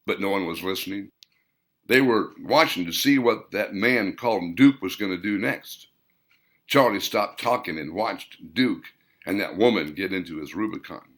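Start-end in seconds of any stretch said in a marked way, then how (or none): none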